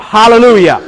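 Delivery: mild shouted command; demonstrated shout. Acted. Preacher teaching a buyer the sled-dog command, mildly shouted. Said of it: "when you shout".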